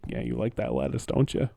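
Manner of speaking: low creepy coaxing voice. Retold or imitated. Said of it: "he's like".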